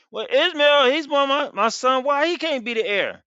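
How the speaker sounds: high, pleading question; pleading father. Acted. Abraham pleading with God for Ishmael, high pleading. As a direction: push for your first son.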